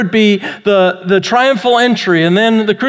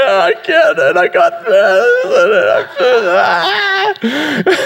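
mock crying; much slower than usual. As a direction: blubber helplessly, playing it for laughs. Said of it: "I'm just starting to cry".

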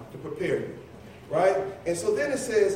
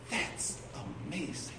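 soft, half-whispered wonder; breath of quiet marvel; hushed awe; 3 semitones lower, 11 dB quieter than usual. Real, not acted. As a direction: drop to a near whisper in amazement. Marvels at God actively working.

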